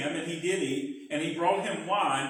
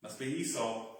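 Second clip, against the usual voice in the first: Isaac's thought, much lower and softer; muttered aside; 9 semitones lower, 11 dB quieter than usual. Acted. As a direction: murmur it to himself, convinced. Isaac's private thought, fooled.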